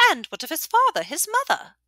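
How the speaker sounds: high, eager questioning voice; pry with relish; delighted old widow. A narrator's reading. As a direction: innkeeper widow asking Bear for gossip.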